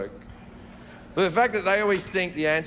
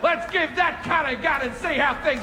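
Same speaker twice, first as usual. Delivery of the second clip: mock weepy teacher; 10 semitones higher than usual. Voicing crying relief teacher.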